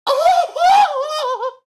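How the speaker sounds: high, loud laughing shout; delighted laughing shriek at work; squealing laugh. Retold or imitated. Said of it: "but my response was".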